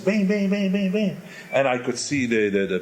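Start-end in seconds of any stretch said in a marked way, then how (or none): 0.0-1.2 imitating banging. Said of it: "I could see the instrumentalists".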